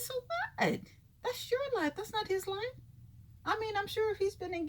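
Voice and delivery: impassioned rant, pitch way up; worked-up rant. Real, not acted. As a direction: rant it like you're defending a friend. Heated rant defending Bobby.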